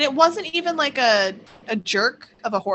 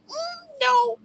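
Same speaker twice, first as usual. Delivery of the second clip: high firm no. Retold or imitated. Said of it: "then I was like".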